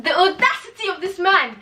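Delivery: high, loud indignant cry; high scandalised outcry; outraged. Real, not acted. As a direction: hit audacity hard in disbelief. Outraged at the character.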